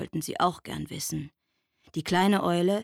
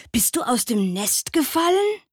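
hedgehog asking; 6 semitones higher, 6 dB louder than usual. A narrator's reading. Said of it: "fragte der Igel".

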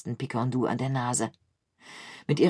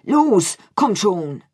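much louder and higher, urging on; urgent call; 5 semitones higher, 7 dB louder, slower than usual. A narrator's reading. Pomme de Terre urges Picandou to flee from the rat army. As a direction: shout to get him moving now. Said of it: "zog ihn hoch und rief".

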